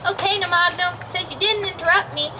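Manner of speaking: ordinary delivery, nothing marked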